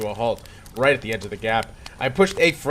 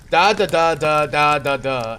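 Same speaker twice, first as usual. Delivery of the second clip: louder sung game melody; humming the theme; 5 dB louder than usual. Retold or imitated. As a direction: hum the Mario theme. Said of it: "the music was becoming".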